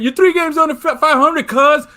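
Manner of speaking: a friend parroting the pitcher's rant, mock yelled; imitating Sabathia. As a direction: mimic the angry pitcher's rant. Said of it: "Like he said".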